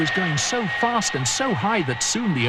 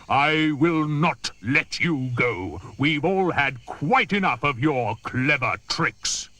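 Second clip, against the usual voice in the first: stern elephant; 6 semitones lower than usual. Acted. Trunky the elephant refuses the crocodile, low and firm. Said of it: "Trunky said".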